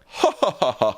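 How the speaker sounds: a hammed-up fake "ha ha ha", jumping high at the start; mock laugh. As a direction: mimic the senators mockingly laughing it off. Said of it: "They went".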